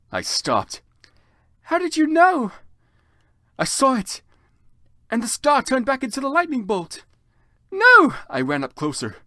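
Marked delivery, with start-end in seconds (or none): 1.7-7.0 high, urgent back-and-forth; higher, louder alarmed talk; excited. Acted. Frantic exchange about scar. 7.7-8.1 very high, loud scream; shouted no. Acted. Character screams.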